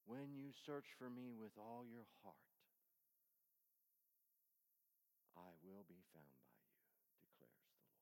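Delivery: whispered scripture. Acted. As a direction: breathe the promise like a secret. Whispered scripture after loud line.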